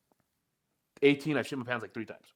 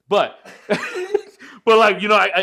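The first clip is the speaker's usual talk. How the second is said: bursting into laughter mid-sentence; laughing; 8 semitones higher, 10 dB louder than usual. Real, not acted. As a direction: laugh through the words, louder and higher. Embarrassed confession about his stool.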